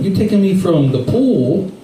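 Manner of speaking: ordinary delivery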